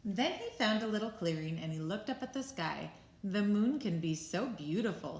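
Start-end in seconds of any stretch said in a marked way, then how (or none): none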